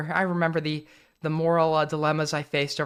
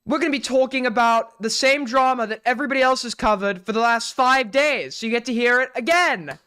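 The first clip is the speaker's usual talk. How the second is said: bad Chud accent; 5 semitones higher than usual. Streamer mocking drama channels in a bad Chud accent. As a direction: ham up a Chud drama-channel intro badly. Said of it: "that's not a good Chud accent".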